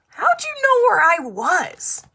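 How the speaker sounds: high, loud surprised question. Acted. Zaina asks her rescuers, high and startled. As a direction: Zaina amazed her friends found her.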